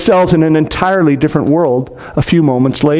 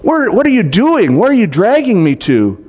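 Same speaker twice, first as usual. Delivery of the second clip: higher, startled, being pulled; boy dragged by magic, alarmed at his sister; alarmed protest; 8 semitones higher than usual. A narrator's reading. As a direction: yelp at a sister who seems to grab you. Peter dragged by unseen force.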